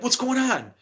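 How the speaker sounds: high, confused question; bewildered. Acted. Waking up and asking his friends, bewildered. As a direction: play someone waking up disoriented.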